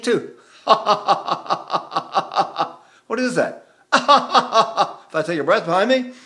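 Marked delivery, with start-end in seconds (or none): 0.6-2.7 long string of even staccato "ha"s; laughing technique. Shown as a demonstration. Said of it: "laughing's a great technique". 3.9-5.1 high, loud staccato "ha ha ha"; laughing technique. Shown as a demonstration. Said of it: "laughing's a great technique".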